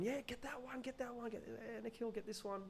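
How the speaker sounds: high, softened cheering voice; distant cheering cousin. Retold or imitated. Cousins egging on the young climber, high mock cheering. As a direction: imitate cheering from below in a small falsetto. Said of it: "like, cheering me on".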